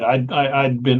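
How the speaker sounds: usual speaking voice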